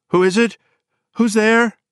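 high, loud, terrified woman's call; shrill, wavering. A narrator's reading. Frightened woman calling to an unseen visitor, shrill and wavering. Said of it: "shrill and wavering though it was".

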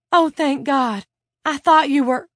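high, loud rush of relief; overwhelmed relief. A narrator's reading. Claudia to her waking cellmate, overwhelmed with relief. Cellmate seems to have woken.

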